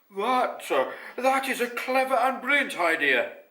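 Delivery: loud, high-pitched lion character; storybook lion, loud and emphatic; Ralph the lion. A narrator's reading. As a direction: praise heartily in a big animated voice. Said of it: "said Ralph the lion".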